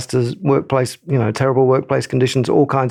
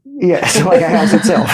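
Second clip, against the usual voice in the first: laughing as she agrees; amused laughing agreement; 7 semitones higher, 4 dB louder than usual. Real, not acted. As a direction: laugh through the words, amused. Shared joke about houses.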